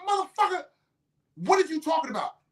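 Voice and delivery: very high-pitched, acted exasperation; incredulous retort. Debater pressing his opponent to explain, incredulous retort. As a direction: replay your disbelief at the claim. Repeats incredulous reply in retold argument.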